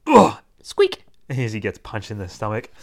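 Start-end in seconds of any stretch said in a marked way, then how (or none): 0.1-0.4 loud, high grunt from a punch; cartoon oof; winded grunt. Tristan is punched in stomach. 0.6-1.0 tiny very high squeak; sudden high cartoon peep; toy squeak. Toy squeaks when punched.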